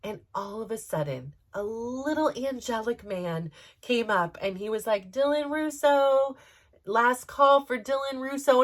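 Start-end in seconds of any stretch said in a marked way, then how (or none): 5.1-8.6 high, stretched-out calling voice; imitated last-call announcer. Airline man paging a late passenger, calling out. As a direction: call the name out slowly, drawing it long.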